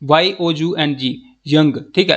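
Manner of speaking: ordinary talk, nothing marked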